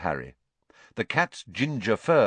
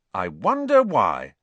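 slow, louder sarcastic jab; 6 dB louder than usual. Acted. Ron mocks Hermione's pity for the cat, slow and sarcastic. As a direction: drip with sarcasm. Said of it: "said Ron sarcastically".

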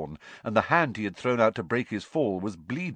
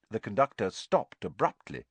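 ordinary delivery

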